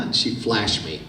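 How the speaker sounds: ordinary talk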